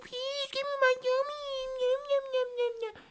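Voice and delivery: mock a grown-up doing baby talk; squeaky mock baby voice; age player. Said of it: "they'll be like".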